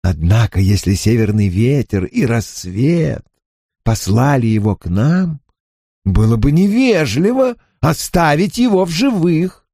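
cold, lofty menace; a proud otherworldly gentleman toying with his prey; haughty spirit voice. Acted.

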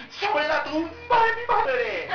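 high, slow mock wail; hammed-up tearful swearing, drawn out; dramatic oath. Dramatic person swearing on mother's grave, mock wailing. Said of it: "Algunos son más dramáticos".

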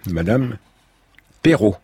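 ordinary delivery

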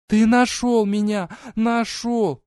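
put-on high, loud girl's voice, elated; overjoyed. A narrator's reading. Verona hugging Foma, high overjoyed cry. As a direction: cry out that he found you.

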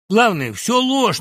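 high, loud, slow protest; insistent. A narrator's reading. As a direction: press the denial hard. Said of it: "напирал Жухов". (напористо, громко)

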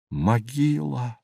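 slow, softened word of dread; hushed dread. Acted. Narrator realizing the pit is a grave, hushed dread. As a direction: let the word sink in, drawn out and soft.

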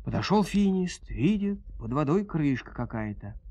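plain reading voice

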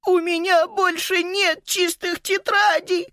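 put-on high, slow wailing girl; crying girl. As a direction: wail it through tears. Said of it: "заплакала Сузи Циклер".

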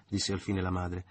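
ordinary delivery

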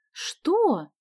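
high, drawn-out puzzled question; baffled. A narrator's reading. Sonya not understanding computer slang, baffled. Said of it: "захлопала глазами Соня".

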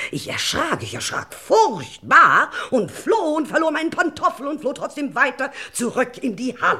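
high, loud, panicked rush; frightened outburst. Acted. Widow telling the lord of her fright, panicked and high. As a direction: relive the terror, voice climbing.

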